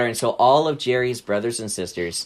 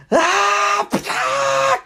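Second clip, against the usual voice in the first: long, high mock war scream; turtle battle cry; 3 dB louder than usual. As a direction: kung fu attack yell, pitched way up. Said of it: "And Jerry went".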